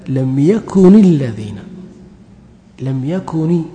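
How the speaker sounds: slow, drawn-out يكن ending in a clear "ni"; kasra on the nun. Shown as a demonstration. Teacher showing students the kasra on the nun, يكن said as "yakuni". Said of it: "في كسرة على النون".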